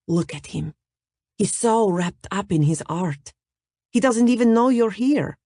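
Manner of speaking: put-on lightly accented, fond teasing; light Russian accent. Natalia about the painter.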